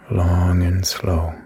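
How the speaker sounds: calm, even meditation voice with long pauses; guided meditation. Acted. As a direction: lead the listener gently through each breath. Leads a guided body meditation.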